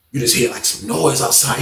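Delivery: normal talking voice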